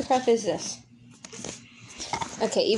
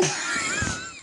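sudden high embarrassed giggle; high laugh; 4 dB quieter than usual. Real, not acted. After accidentally telling listeners she loves them, a high giggle. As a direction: burst out in a squeaky laugh.

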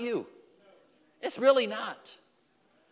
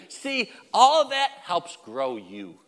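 slow emphasis; much slower than usual. Real, not acted.

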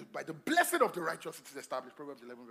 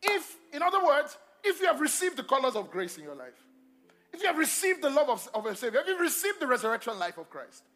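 loud, high-pitched preaching to a congregation; emphatic preaching; 7 semitones higher, 9 dB louder than usual. Real, not acted. Preacher urging believers on Christ's resurrection life, emphatic preaching. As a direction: drive each clause home to the crowd.

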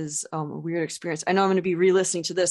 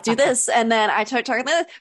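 bright chatty pitch lifted up, a bit louder; match a girl friend's higher voice; higher, louder girly voice; 4 semitones higher, 5 dB louder than usual. Shown as a demonstration. Podcaster showing how she talks to girls her age, higher and louder. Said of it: "I start talking like this".

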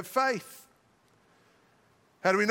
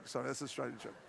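much lower, very soft apology; quiet apologetic aside; 11 semitones lower, 15 dB quieter, much faster than usual. Real, not acted. Preacher apologizing to congregation for an Australian joke, quiet aside. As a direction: drop right down as a muttered aside.

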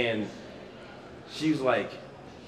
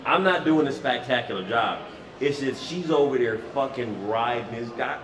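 normal talking voice

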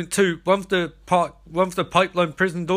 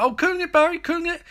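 high, sneering echo of his words; sarcastic mock; 14 semitones higher than usual. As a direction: throw his own question back at him, mocking. [name] mocking Barry's answer.

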